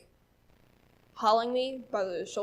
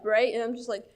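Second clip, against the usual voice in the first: ordinary delivery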